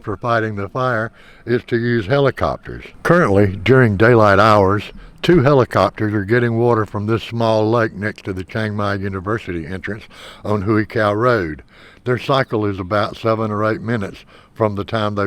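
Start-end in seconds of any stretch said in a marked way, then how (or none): none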